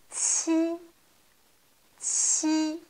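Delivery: « Qi » tenu haut et plat; « Qi » sur une note haute; qi premier ton. Shown as a demonstration. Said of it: "Premier ton". (qi au premier ton)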